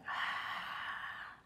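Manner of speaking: hissing throat breath through the nose; ujjayi exhale. Shown as a demonstration. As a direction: fog a mirror but through the nose. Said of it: "So you're kinda going".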